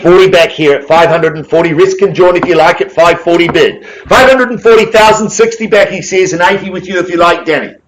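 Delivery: rally the room for opening bids; auction calling. Real, not acted.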